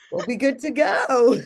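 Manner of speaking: laughing through the words. Real, not acted. Laughing at recording trouble.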